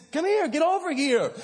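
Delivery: high-pitched retold call to a child; calling over. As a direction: beckon a little one over, pitched well up. Dad calling lost son over.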